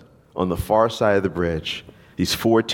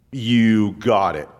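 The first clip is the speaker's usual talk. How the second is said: slow and steady, each word weighed; slow assured God voice; slower than usual. Acted. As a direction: grant the prayer slowly, with calm certainty. God answering a prayer.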